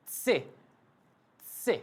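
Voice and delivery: से said starting from a light t, tongue touching the top; German letter C pronounced tse. Shown as a demonstration. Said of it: "उससे पहले त लगाना है आपको".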